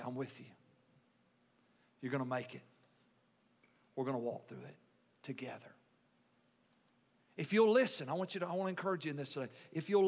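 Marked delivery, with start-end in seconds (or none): 0.0-5.8 low, soft, reassuring voice; tender reassurance. Acted. Preacher voices the Lord comforting his people, tender reassurance. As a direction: drop right down, pausing between promises.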